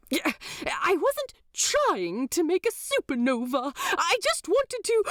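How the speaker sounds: a prim angel, caught out and flustered; Aziraphale voice. A narrator's reading. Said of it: "Aziraphale's round cheeks grow even redder".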